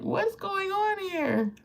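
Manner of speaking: high, slow, bewildered; drawn out in amused disbelief; mock bewilderment. Real, not acted. To viewers about being weepy at fifty, high and bewildered. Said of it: "I've been weepy".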